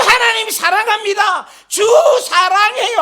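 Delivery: loud, high cry of devotion; fervent praise shout. Retold or imitated. Preacher imitates himself leaping in worship, in a high fervent shout. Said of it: "하면서 팔짝팔짝 뛰는데".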